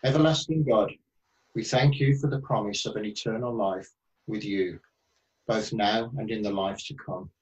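normal talking voice

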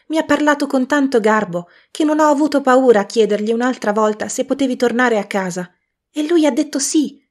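voce di bambina più alta e più forte, piena di gioia; bambinetta gioiosa. A narrator's reading. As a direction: una sorellina felice che corre dal fratello. La bambina Fan parla. (bambina entusiasta)

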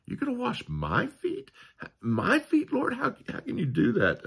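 overplayed shocked modesty; false humility. Peter feigning humility before Jesus, high mock-humble voice. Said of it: "impression of false humility".

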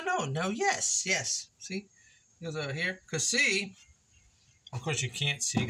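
ordinary delivery

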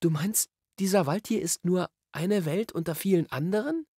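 put-on high, questioning girl-character voice; Polly, wondering. A narrator's reading. Polly asks whether the wood is one world, wondering. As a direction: ask it high, piecing it together.

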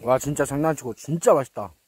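chewing while talking; speaking around a mouthful; mouth full. Real, not acted. Seen 0: just fed himself; lips pursed, mouth closed around food while speaking.

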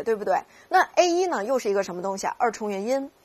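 normal talking voice

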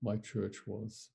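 slow, hushed admission; low, subdued, barely voiced confession; very quiet reply. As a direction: retell his answer softly and slowly. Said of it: "he very quietly said".

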